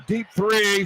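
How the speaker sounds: ordinary delivery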